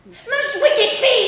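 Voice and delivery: sudden cry, pitched high; loud outburst. Acted. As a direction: blurt it out loud so he hears. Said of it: "I heard you that time".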